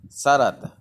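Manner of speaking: ordinary talk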